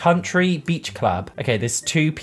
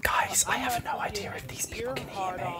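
soft unvoiced whisper; 8 semitones higher, 10 dB quieter than usual. Real, not acted. Nervous player hoping strangers can't hear her mic, whispering. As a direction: hush it so nobody can hear. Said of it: "I feel like I need to whisper".

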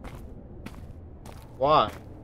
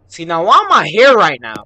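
louder and higher, suddenly alarmed; alarmed outburst; 5 semitones higher, 15 dB louder than usual. Real, not acted. Gamer yelling at the game's creepy warning, alarmed. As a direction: blurt it out in alarm.

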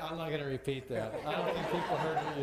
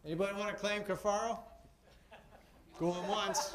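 higher, projected call to the crowd; playful call-out; 6 semitones higher than usual. Pretend. Host asks the audience who claims the sailor, playful call-out. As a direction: call it out like a joking auctioneer.

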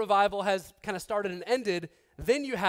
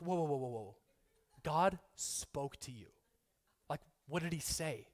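hushed amazement; 6 semitones lower, 9 dB quieter than usual. Retold or imitated. Newcomer amazed God speaks to them.

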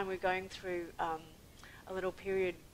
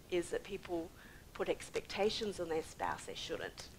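ordinary talk, nothing marked

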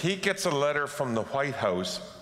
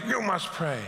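higher, strained mock pastor's cry; retold preacher's scream; 5 semitones higher than usual. Pastor pulling his tie down, mock scream. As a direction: imitate a pastor working himself up. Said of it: "he would sweat and scream".

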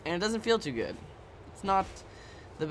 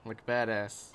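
ordinary talk

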